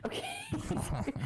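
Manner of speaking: high, cracking laughing sorry; laughing apology. Real, not acted.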